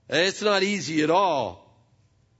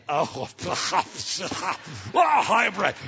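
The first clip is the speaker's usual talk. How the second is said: mocking laugh; much slower than usual. Other guy mocks hybrid owner.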